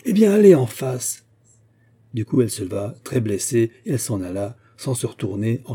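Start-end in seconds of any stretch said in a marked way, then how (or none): 0.1-1.3 a much higher, louder furious outburst; angry shout. A narrator's reading. Baudu, losing his temper, shouts at the customer in fury. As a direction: let the long-held grudge explode. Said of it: "il cria, secoué de colère contenue".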